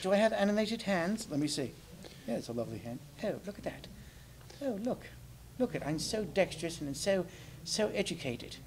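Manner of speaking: prim English gentleman puppet, preening; British puppet. Pretend. Holds British puppet character.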